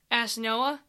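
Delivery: ordinary delivery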